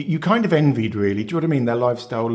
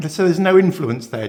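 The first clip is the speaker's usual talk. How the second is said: ordinary talk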